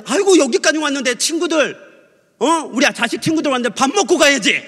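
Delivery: high, pressing motherly invitation; insistent mother. Retold or imitated. Mother urging her son's friends to eat, high and insistent. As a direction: urge the guests to stay, pitched high. 종석이 엄마가 붙잡는 말 흉내.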